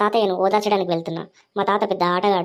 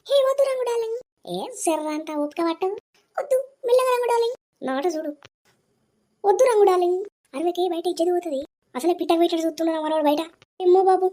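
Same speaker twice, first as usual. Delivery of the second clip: much higher, squealing woman's voice; coy woman; 10 semitones higher than usual. Acted. Woman with Rangu.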